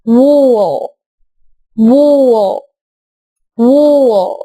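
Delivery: وو said as a long rounded oo; drawn-out oo sound. Shown as a demonstration. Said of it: "فتقول". (صوت وو طويل مدور)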